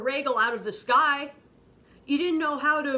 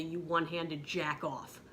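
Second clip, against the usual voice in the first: normal talking voice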